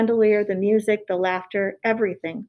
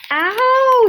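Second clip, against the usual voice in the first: high, loud yelp; scream of pain; 14 semitones higher, 8 dB louder than usual. A narrator's reading. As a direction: shriek as the tail is cut. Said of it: "screamed Roscuro".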